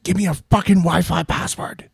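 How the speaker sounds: high, strained creature voice; Smeagol yelling. Retold or imitated. British guy demanding the neighbor's password, mock Smeagol yell. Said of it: "turns into fucking Smeagol when he's yelling at him".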